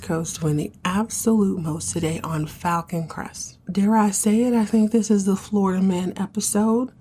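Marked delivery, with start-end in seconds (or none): none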